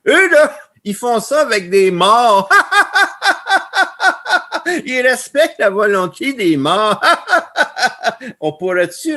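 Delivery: loud, high, cracking up between phrases; laughing hard. Real, not acted. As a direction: burst into big laughter at your own punchline. Tells joke, bursts out laughing. (éclats de rire, voix forte et aiguë)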